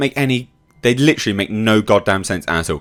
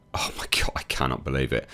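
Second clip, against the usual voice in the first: whispered disbelief; 8 semitones lower, 11 dB quieter than usual. Real, not acted. Reviewer appalled by the credit scenes, whispering.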